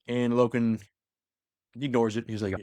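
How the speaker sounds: ordinary talk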